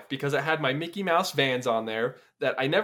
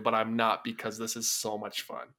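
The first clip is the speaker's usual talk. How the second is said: dour and sad; 8 semitones lower than usual. Pretend. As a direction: play the gloomy one. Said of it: "I'm the Haunted Mansion guy".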